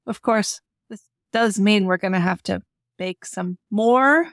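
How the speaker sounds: ordinary talk, nothing marked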